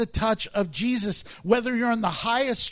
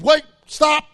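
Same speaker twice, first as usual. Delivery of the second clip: loud, higher call to stop her; sudden command; 6 semitones higher, 10 dB louder than usual. Acted. Jesus calling out the woman sneaking away, loud and commanding. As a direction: halt her firmly with a raised voice. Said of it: "He said".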